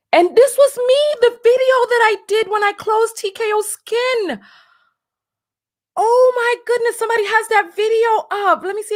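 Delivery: high, loud, thrilled; gasping with delight at finding it; excited. Real, not acted. Vlogger finds her old farewell video online, thrilled.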